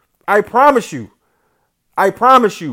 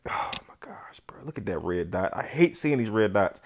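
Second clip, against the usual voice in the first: much softer, breathy under-the-breath groan; whispered dismay; 9 semitones lower, 12 dB quieter than usual. Real, not acted. Gamer muttering at the red dot on screen, whispered dismay. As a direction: hush it, deflated.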